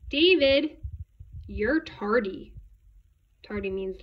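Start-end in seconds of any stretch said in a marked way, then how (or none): 0.1-2.5 loud high call on the name, then slow; teacher catching a latecomer; scolding teacher. A narrator's reading. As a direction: call out the pupil's name, then draw out the reproach. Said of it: "David's teacher always said".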